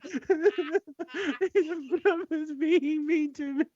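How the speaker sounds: high, very slow mock whimper; mock crying. Friend imagining Ryan in tears, high and drawn-out whimper. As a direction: drag it out like a teary child. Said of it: "and he's like".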